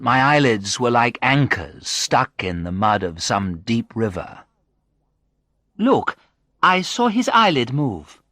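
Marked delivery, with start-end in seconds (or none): 5.8-8.2 high-pitched, excited bystander; third voice. A narrator's reading. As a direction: pitch it up as another person spotting movement. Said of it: "a third voice said".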